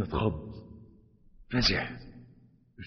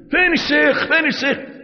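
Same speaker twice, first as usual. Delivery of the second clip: much higher, urgent repeated call; frantic search; 4 dB louder than usual. Acted. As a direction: call out desperately for the sheikh. Running looking for the sheikh.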